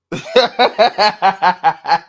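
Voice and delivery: big loud cackle; loud laughing fit. Real, not acted. Laughing hard at rival fans.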